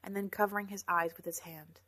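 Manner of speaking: ordinary delivery, nothing marked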